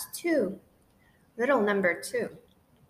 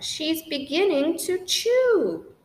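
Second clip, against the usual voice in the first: alarmed; 5 semitones higher, 5 dB louder than usual. Acted. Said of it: "I cried out".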